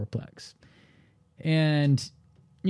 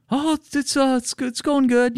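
voicing the client. Client answering awkwardly.

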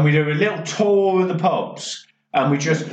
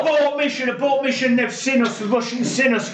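much higher, urgent orders; frantic mock command; 8 semitones higher, faster than usual. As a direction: call off the mission in a panic. HQ calling off the mission.

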